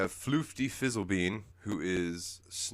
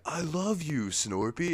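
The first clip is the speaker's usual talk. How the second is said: a mock-tender higher voice; tender Chandlo; 9 semitones higher, 3 dB louder than usual. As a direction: say it with a playful sweetness. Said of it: "and he's like".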